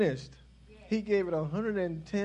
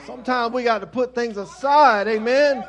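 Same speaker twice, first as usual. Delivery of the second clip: louder exhortation to the church; preaching; 3 semitones higher, 10 dB louder than usual. Acted. Pastor urging the congregation to put things aside, preached louder. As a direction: drive it home to the pews.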